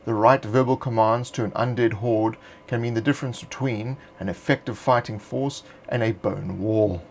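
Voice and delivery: ordinary talk, nothing marked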